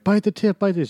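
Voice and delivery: high, loud playful mimic; mock shouted direction. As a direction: call it out like a director. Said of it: "they're like".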